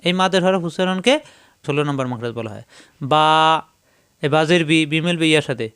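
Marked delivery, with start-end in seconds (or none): 3.1-3.3 বা with a long, held a; lengthened vowel. Shown as a demonstration. Teacher demonstrating madd to learners, lengthened vowel. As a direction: draw out বা as a madd. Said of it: "এই লম্বা করলে এটাকে বলা হয় মাদ".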